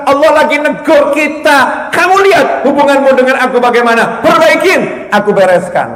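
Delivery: loud, high, insistent sermon voice; impassioned preaching. Acted. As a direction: drive each phrase home to the congregation. Preaches at full force.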